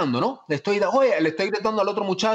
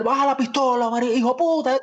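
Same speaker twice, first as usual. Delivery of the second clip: higher, mock-furious yell at a scene partner; retold shouting; 8 semitones higher than usual. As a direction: shout it like an actor at an audition. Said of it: "me pongo a gritar". (gritando como en una audición, en broma)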